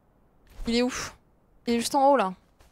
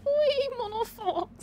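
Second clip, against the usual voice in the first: put-on high, trembling old man; 12 semitones higher, much slower than usual. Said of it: "Il faut que tu fasses la voix tremblante un peu".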